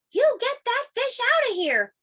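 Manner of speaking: high, angry mock yell at the boy. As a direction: shout them away from the pool. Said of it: "they yelled".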